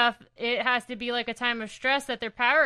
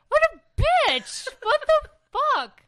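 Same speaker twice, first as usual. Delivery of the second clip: scandalized outburst; 6 dB louder than usual. Real, not acted. Co-host hearing she left Ben for Johnny, shrieking in shock. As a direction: shriek it in gleeful disbelief, stretching the words. Shocked reaction to comic's love triangle.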